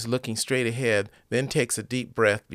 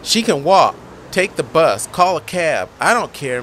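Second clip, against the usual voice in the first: loud, higher angry snap; furious dismissal of her friend; angry answer; 5 semitones higher, 8 dB louder than usual. Acted. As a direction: spit out each short order. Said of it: "Megan angrily answers".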